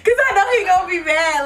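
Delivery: high giggles breaking through the words; can't keep the laughter in while planning; laughing. Real, not acted. Girl laughing to her viewers about her prank.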